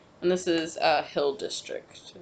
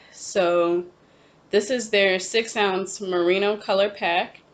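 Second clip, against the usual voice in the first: usual speaking voice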